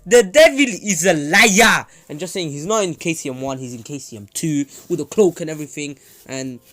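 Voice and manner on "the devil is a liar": hammed-up high, slow, loud denunciation; stunned shouting. Stunned Kushina sees Naruto's changed eyes, hammed-up alarmed shout. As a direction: cry out as if facing a demon. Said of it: "Kushina is stunned".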